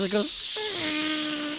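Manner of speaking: high squeal like air escaping; squealing balloon noise. Retold or imitated.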